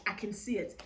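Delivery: normal talking voice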